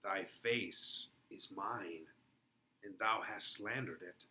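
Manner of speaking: slow, low and soft, savoring thy; acted Paris line. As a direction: lay claim to her face slowly and quietly. Paris line performed in scene.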